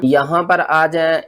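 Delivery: ordinary talk, nothing marked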